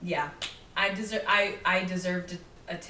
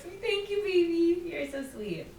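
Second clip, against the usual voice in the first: mock sweet coo; 7 semitones higher than usual. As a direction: coo it like a doting girlfriend. Cooing at coworker Zoo.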